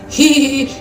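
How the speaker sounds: put-on hee-hee giggle; mock giggle. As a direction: giggle inappropriately, as an act. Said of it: "कोई हंसता है क्या". (बनावटी ही-ही वाली हँसी)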